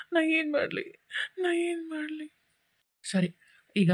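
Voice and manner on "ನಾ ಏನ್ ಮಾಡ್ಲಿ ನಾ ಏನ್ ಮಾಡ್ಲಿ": high, soft, despairing wail; crying in helpless despair; helpless sobbing. Acted. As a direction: sob it out, utterly lost. Jilted man breaks down crying.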